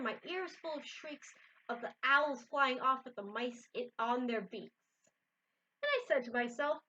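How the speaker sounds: lively read-aloud voice for a picture book; story narration. A narrator's reading. Reader to viewers, reading a Seuss book aloud. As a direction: tell the rhyme with bounce.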